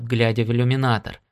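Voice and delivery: ordinary delivery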